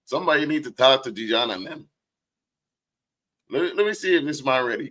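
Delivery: ordinary delivery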